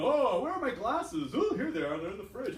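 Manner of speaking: hammed-up forgetful grandpa voice; confused old man.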